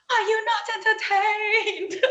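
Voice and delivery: high, loud, slow theatrical shout; gladiator. Retold or imitated. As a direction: bellow to the arena in mock triumph. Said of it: "I felt like the gladiator".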